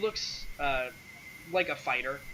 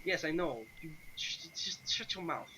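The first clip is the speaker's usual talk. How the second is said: mildly exasperated, higher-pitched stammer; exasperated hushing; 4 semitones higher, 4 dB quieter than usual. Acted. Sergei hushes the adventurer before the Count, mildly exasperated. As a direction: hush someone with strained patience.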